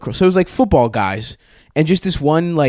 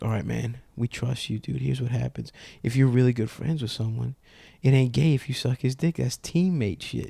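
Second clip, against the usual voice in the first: confiding; 10 dB quieter than usual. Retold or imitated. Teammate pulling the kid aside, quietly confiding. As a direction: take him aside and confide in him.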